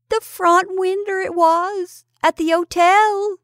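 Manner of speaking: high, slow, drawn-out wail; loud childish howl, pitched high; wailing boy. A narrator's reading. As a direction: wail it out like a scared village lad. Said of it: "wailed the boy".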